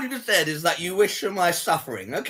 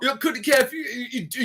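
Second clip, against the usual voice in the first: high stammering mimicry; mocking imitation; 5 semitones higher than usual. Streamer apes caller who couldn't care, mockingly. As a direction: parrot the caller in a higher mocking voice. Parrots caller's accent and words.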